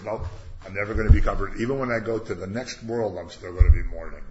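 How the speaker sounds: loud, fast, stubborn refusal; mock defiant wail. Jacob refusing his children's comfort, loud and stubborn. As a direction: belt out the grief like an overwrought father.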